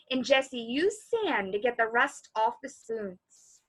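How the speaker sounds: ordinary delivery, nothing marked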